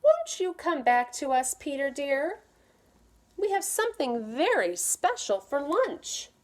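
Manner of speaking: mother calling Peter to lunch. Acted. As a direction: call sweetly from the window. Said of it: "His mother came to the window and called".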